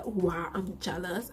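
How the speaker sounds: ordinary delivery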